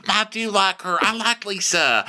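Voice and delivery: high, drawn-out protest; Sutton. Retold or imitated. Sutton insisting she likes Lisa, high mock protest. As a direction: whine it in caricature, starting very slow. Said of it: "She goes".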